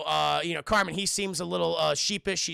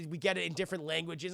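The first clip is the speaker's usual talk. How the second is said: ordinary talk, nothing marked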